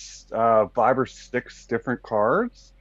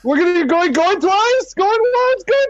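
higher and higher going once twice; excited; 4 dB louder than usual. Acted. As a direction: build the hype on each call. Hyping the final call.

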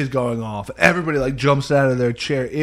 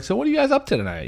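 high and fast; much faster than usual. Retold or imitated. Small talk, high and quick.

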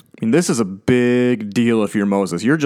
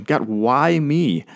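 pleading question; 4 semitones higher, much slower than usual. Acted. Voicing the question asked of God.

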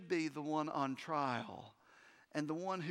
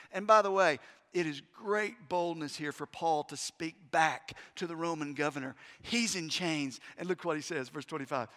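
ordinary talk

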